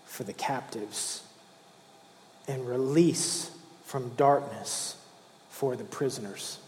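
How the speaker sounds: slow, soft, reverent reading of the prophecy; solemn scripture reading. Acted. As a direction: read it gently, like a sacred text. Reads Isaiah aloud softly.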